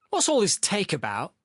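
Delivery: high-pitched aggrieved question; upset protest. A narrator's reading. Sharikov objecting to losing mayonnaise, upset. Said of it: "Sharikov protested, upset".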